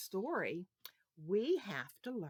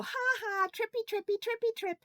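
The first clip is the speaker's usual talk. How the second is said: much higher, louder jeering sing-song; mocking taunt; 6 dB louder, faster than usual. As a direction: tease someone who tripped, in a high taunt. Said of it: "someone falls and you go".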